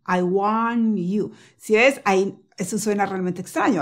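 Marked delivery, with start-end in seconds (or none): 0.1-1.3 very slow 'I want you' as waan-you, no T; T left out. Shown as a demonstration. Teacher showing how strange it sounds, no T at all. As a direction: stretch 'want' on the N, dropping its T. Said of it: "como si no estuvieras haciendo ninguna T".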